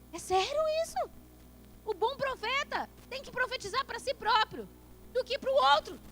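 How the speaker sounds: high, loud, impassioned sermon cry; fiery preaching. Acted. Preacher rousing the congregation, loud fervent preaching. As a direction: preach it out to the whole church.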